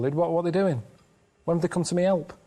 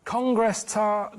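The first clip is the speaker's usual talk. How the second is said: "Congress tart" even slower and deliberate; slower repeat; 4 semitones higher, much slower than usual. Pretend. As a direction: stretch out "Congress tart" for emphasis. Repeating code after "What?.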